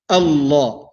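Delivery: Allah with a heavy, full lam. Shown as a demonstration. Teacher repeating the heavy lam for students. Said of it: "we say Allah in a heavy manner".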